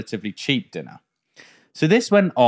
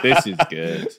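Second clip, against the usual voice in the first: lower, flat drone; deadpan mock praise; monotone voice; 7 semitones lower than usual. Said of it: "monotone voice".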